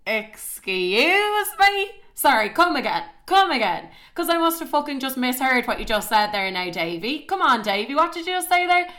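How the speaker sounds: high, loud indignant confrontation; outraged disbelief. Retold or imitated. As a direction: demand that he repeat himself, scandalised. Confronts him over his confession.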